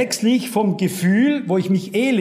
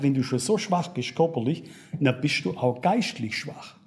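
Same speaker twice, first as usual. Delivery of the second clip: devil's voice; 7 semitones lower, 6 dB quieter than usual. Retold or imitated. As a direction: lower and softer, a mock devil's taunt. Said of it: "Der Teufel sagt".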